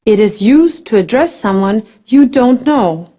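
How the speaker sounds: ordinary talk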